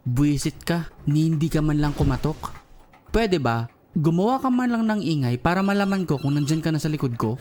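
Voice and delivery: ordinary talk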